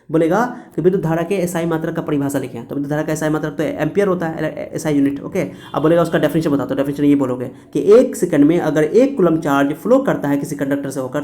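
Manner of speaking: usual speaking voice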